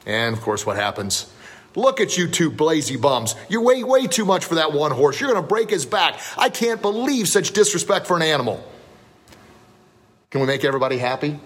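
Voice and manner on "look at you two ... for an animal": high, indignant imitation of a heckler; mock outrage. Onlooker berates two riders overloading one horse, mock outrage. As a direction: scold in a hammed-up, raised pitch.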